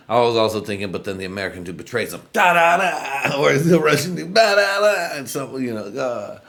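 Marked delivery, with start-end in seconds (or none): 2.3-3.4 high, loud, drawn-out da-da-da; hammed-up sudden-betrayal sound effect; imitated attack noise. 4.4-5.2 very high, loud da-da-da; hammed-up sudden-betrayal sound effect; imitated attack noise.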